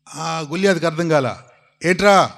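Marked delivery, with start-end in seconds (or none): 1.9-2.3 mocking shout. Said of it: "అని అన్నాడు".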